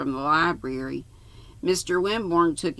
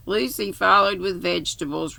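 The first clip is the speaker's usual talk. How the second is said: plain reading voice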